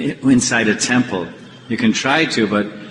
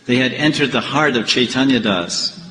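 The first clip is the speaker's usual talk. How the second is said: ordinary talk, nothing marked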